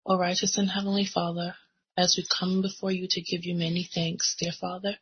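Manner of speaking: ordinary talk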